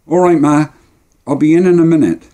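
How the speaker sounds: put-on loud, high boy answering his mum; boy calling back. Boy telling Ma he'll be in, calling back.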